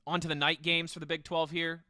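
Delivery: ordinary talk, nothing marked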